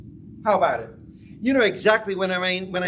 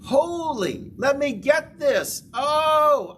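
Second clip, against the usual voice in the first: excited realization; 9 semitones higher, 3 dB louder, slower than usual. Acted. Acting a sudden realization.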